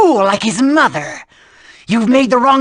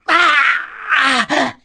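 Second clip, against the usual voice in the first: normal talking voice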